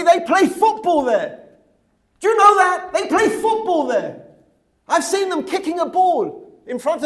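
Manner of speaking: very loud, high-pitched indignant rant; outraged shouting. Real, not acted. Teacher decries football at the holy site, outraged shouting.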